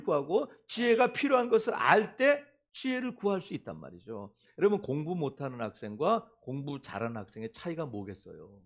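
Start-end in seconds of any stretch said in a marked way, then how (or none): none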